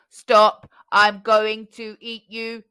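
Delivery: loud, slow robotic bellow; giant shouting. A narrator's reading. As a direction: roar the threat. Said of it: "shouted the giant".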